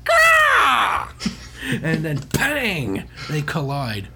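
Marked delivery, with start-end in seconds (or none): 0.0-1.1 high shrieking mock roar, much louder; monster roar. Host acting the alien's attack cry, hammed-up shriek.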